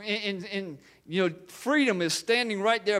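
ordinary delivery

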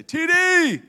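loud, high call across a crowd. Retold or imitated. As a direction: shout his name like a fan. Crowd calling out to dad.

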